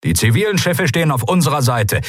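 high, projected commander's message; fleet radio announcement. Acted. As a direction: announce it briskly to the whole fleet. First take of repeated fleet message.

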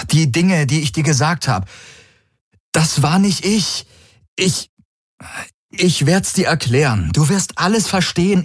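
high, pressing warning to the fleet; urgent envoy. A narrator's reading. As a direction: plead urgently over the comm, pitched high. Envoy pleads and promises. (eindringliche, hohe Warnung an die Flotte)

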